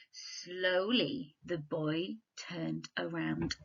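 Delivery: very slow, drawn-out reading; slow suspenseful read. Shown as a demonstration. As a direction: stretch "Slowly" out to build suspense. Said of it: "a dot, dot, dot, an ellipsis on this sentence would add suspense".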